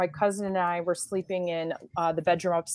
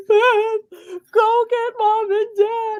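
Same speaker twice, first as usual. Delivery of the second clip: much higher and louder, slow desperate call; mock panicked yell; 6 dB louder, much slower than usual. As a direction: yell for help like a hurt kid. Brother hurt calling for help.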